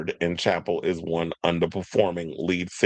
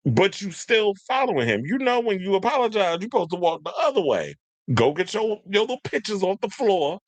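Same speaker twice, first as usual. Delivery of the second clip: high-pitched, incredulous telling-off; exasperated scolding; 9 semitones higher than usual. Retold or imitated. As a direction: scold them as if shooing them away. Acted scolding of the fans.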